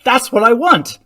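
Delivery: loud, high burst of enthusiasm; enthusiastic retort. Shown as a demonstration. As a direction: fire back with hammed-up enthusiasm. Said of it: "enthusiastic retort".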